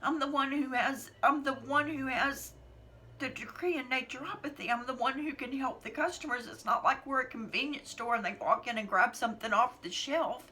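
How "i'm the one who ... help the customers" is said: emotional, close to tears. Real, not acted. Voice raised, emotional throughout.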